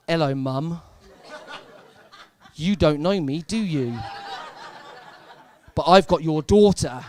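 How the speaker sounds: loud, laughing mock phone threat; creepy prank caller. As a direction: menace the mum down the phone, half laughing. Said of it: "It's me".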